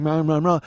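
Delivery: flat repeated mah drone; droning chant. Retold or imitated. Guy in front heard him, a droning mah chant. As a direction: hum the syllable over and over. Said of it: "heard me going".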